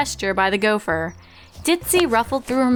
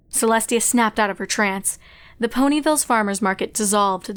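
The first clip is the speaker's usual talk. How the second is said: usual speaking voice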